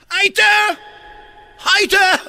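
high, short mock French yells; French cry for help. Lost Frenchman calls for help, high shout. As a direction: shout for rescue in a French accent. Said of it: "en Francia es".

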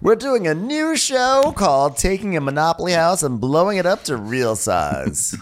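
mock TV host. Continuing host voice.

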